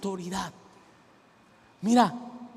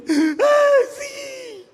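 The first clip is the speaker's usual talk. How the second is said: relieved cry; 7 dB louder than usual. Retold or imitated. Freed girl crying out.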